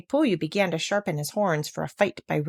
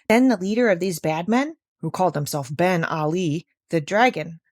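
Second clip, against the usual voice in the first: ordinary delivery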